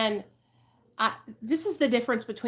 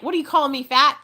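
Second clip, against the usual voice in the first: mock offended; 6 semitones higher, 7 dB louder, faster than usual. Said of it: "And I was so mad".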